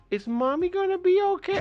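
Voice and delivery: put-on high little child's voice, worried; scared kid. Storyteller voicing the kids in the car, high childlike worry. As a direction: ask it like a small frightened kid. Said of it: "the children's like".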